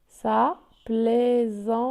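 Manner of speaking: "plaisanterie" split into plai-zan-te-rie; syllable by syllable. Shown as a demonstration. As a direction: give each syllable of "plaisanterie" its own beat. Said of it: "où se trouve la syllabe". (« plaisanterie » découpé syllabe par syllabe)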